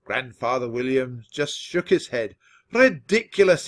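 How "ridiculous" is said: loud, high outburst; crying out. A narrator's reading. Grandfather rejects the grandson's excuse, crying out. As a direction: dismiss it with a loud cry. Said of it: "he cried".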